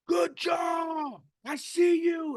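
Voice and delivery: full-volume, high coach's holler; retold cheering shout. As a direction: cheer the team on from far away, drawn out. Re-enacts shouting praise while clapping.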